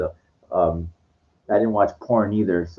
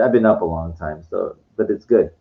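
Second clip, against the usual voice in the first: ordinary talk, nothing marked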